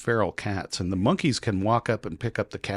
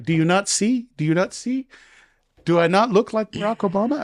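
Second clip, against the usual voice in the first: much higher put-on officer voice; imitating the officer; 12 semitones higher, 5 dB louder than usual. Immigration officer teasing the American traveler, high put-on voice. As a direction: play the passport officer, pitched way up and sly.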